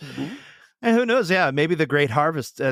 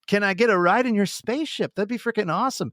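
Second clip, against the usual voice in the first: higher, excited welcome; imagined alien and eager self; 6 semitones higher than usual. Retold or imitated. Cohost acting out greeting a visiting alien, excited. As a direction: greet the alien like an old friend.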